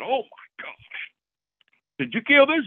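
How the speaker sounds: stunned by the rack, ask if he really shot it; amazed disbelief. Retold or imitated.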